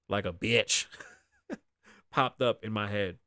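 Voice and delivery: usual speaking voice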